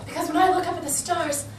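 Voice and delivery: soft romantic. Acted. Romantic line under stars.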